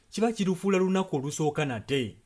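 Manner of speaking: ordinary talk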